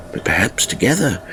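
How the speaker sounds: plain reading voice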